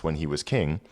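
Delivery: ordinary delivery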